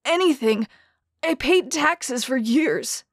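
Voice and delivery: a starving man's cry; begging. Acted. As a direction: beg for food at the window. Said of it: "the man begged".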